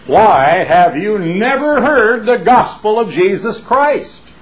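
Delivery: full-throated impression of the old evangelist father; imitating his father. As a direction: shake the jowls and boom it like his father. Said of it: "to imitate his father as perfectly as he knows how".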